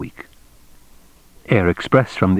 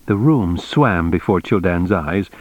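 plain reading voice

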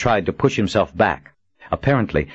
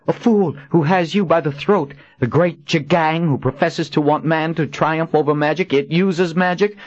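higher, scornful retort; heroine voice; 9 semitones higher than usual. A narrator's reading. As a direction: throw it back in his face. Kahlan retorts.

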